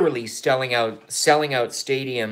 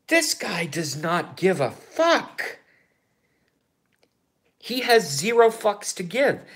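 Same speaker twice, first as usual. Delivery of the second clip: high, slow, emphatic delight; excited; 9 semitones higher, slower than usual. Real, not acted. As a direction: relish every word, amazed. Excited admiration.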